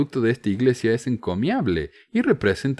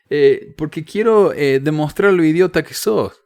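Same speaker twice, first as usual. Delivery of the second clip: mock insulting invite; 7 semitones higher, 7 dB louder than usual. Shown as a demonstration. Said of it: "No le voy a decir". (invitación burlona e insultante, más fuerte)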